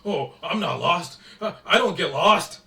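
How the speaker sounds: loud, hammed-up denial; mock defensive. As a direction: imitate him protesting too much, loudly. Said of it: "this guy wants to play in it like".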